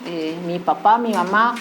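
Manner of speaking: usual speaking voice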